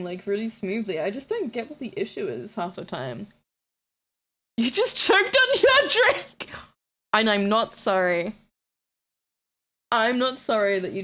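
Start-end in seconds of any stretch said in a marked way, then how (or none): 4.6-6.5 much higher, louder, drawn-out yell; teasing shout. Real, not acted. Streamer to viewer who choked, teasing shout. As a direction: hoot it at them in glee.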